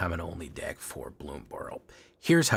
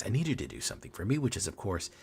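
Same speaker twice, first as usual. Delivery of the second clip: usual speaking voice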